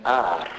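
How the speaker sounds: final R as a long rolled "rrr"; rolled letter sound. Shown as a demonstration. Teacher shows how R is pronounced, a sustained trill. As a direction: trill R on its own, no vowel. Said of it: "R is pronounced as R".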